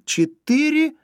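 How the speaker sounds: very high, loud, elated voice; delighted. A narrator's reading. Zhenya questions Yura about his machine, thrilled. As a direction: burst out with rapturous excitement. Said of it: "повторил Женя с восторгом".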